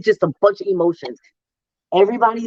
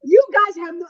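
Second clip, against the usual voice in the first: tearful; 9 semitones higher than usual. Real, not acted. Tearful at the memory.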